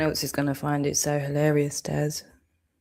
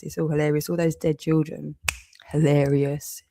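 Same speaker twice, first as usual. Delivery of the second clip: ordinary delivery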